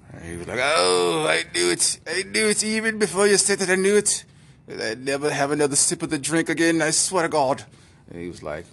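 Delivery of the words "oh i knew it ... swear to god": higher, louder Irish lament; despairing Irish drunk vowing; mock Irish accent. Malcolm hearing the diagnosis, loud higher Irish lament. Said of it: "Malcolm's Irish voice".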